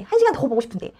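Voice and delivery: normal talking voice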